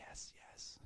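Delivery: barely audible whisper; hushed inner voice; whispered thought. Acted. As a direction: whisper it like a private hope. Paralyzed man's eager thought.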